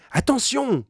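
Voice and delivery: high, loud warning shout; alarmed cry. A narrator's reading. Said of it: "cria Penellan".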